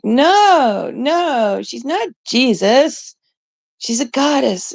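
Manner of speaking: high, drawn-out mock objection; exasperated protest. Arguing with stubborn AI in retelling.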